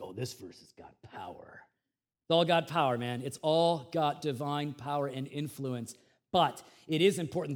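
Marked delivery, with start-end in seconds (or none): 0.0-1.6 hushed whisper. Retold or imitated. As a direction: breathe it like a secret. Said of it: "We tend to think like".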